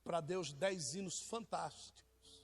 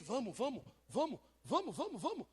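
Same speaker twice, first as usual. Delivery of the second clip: eager urging; 6 semitones higher, 3 dB louder than usual. Retold or imitated. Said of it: "O que que a esposa disse?".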